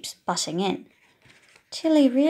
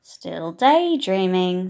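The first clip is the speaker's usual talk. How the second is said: slow, louder tut; family sighing over a dreamy child, slow; family exasperated; 3 semitones lower, 8 dB louder, slower than usual. Acted. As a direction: drag it out as weary family. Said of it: "says Mum".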